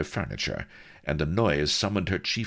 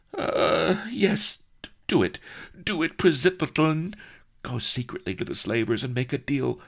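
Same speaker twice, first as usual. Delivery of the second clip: dazed princess; 8 semitones higher than usual. A narrator's reading. Princess urging her maid, high dazed urgency. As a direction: come back to yourself and urge her.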